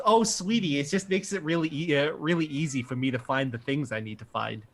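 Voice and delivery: a mother excusing her cluttered computer to her son; Minnesotan mom. Acted. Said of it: "She says".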